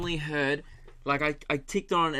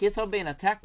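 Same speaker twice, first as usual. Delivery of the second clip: higher-pitched imitation of a Kiwi caller; New Zealand accent; 5 semitones higher than usual. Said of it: "I've got it now".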